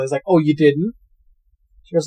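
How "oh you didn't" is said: mildly mocking retold question; imitated doubting tease; sarcastic. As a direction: feign surprise, lightly sarcastic. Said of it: "looks around sarcastically".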